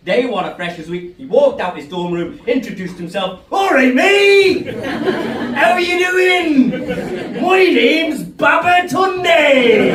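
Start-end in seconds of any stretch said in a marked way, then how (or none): 3.5-9.9 put-on loud, high, drawn-out Cornish accent; Cornwall accent. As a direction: bellow the greeting in broad Cornish. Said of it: "not expecting this Cornwall accent".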